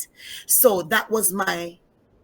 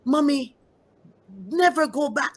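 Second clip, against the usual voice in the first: put-on higher child voice, earnest pleading; a little girl insisting her father won't change; voicing her young daughter; 5 semitones higher than usual. Mother retelling her daughter's warning, higher pleading child voice. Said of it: "She said".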